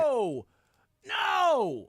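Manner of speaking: high, loud protesting shout; outraged no. Real, not acted. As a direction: shout it down in disbelief. Friend praises rival burger.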